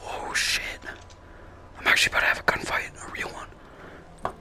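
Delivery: hushed fast nervous whisper; scared whisper. Real, not acted. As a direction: whisper so it can't hear. Hiding from monster, whispering.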